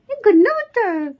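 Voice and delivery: squeaky high playful telling-off; mock scolding. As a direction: tease the baby as a naughty boy, very high. (बच्चे को प्यार से बहुत ऊँची आवाज़ में डाँटना)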